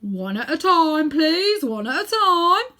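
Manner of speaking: slow, loud, high calming order; policeman. Acted. Policeman quieting the shouting claimants, slow and loud. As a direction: hold the crowd back, one by one. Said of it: "said the policeman".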